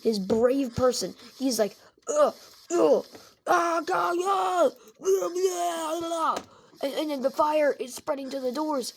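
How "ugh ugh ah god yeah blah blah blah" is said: straining yells. Acted. Michael struggling through fire.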